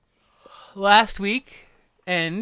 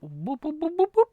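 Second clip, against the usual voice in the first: high boops; 13 semitones higher than usual. Acted. Playful sounds at pet.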